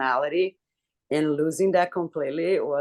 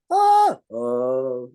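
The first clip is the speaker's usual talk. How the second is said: a louder sung "oh" sliding in pitch; vocal slide demo; 3 semitones lower, 6 dB louder than usual. Shown as a demonstration. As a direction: show a voice running up the scale. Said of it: "You go up".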